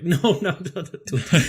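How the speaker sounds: high, loud mimicked objection; mock alarmed protest. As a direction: blurt out rapid noes in mock panic, laughing. Acting out business partners' alarmed reaction.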